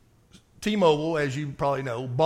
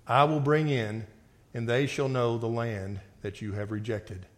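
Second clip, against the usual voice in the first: scripture reading; 4 semitones lower than usual. Acted. Preacher reading God's judgment to the congregation, grave reading voice.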